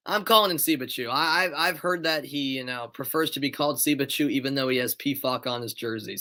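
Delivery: ordinary talk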